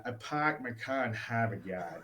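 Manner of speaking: badly faked Boston accent with dropped r's; your best shot at Boston-speak; attempted Boston accent. Shown as a demonstration. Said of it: "that's the best I got".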